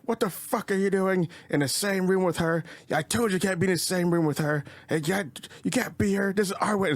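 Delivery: high-pitched imitation of an irate bride; bride confronting groom. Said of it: "she gets in his face".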